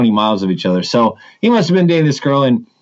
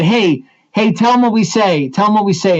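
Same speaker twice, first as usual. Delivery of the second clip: eager prompting; 6 semitones higher than usual. Retold or imitated. Mom prompting her son, acted.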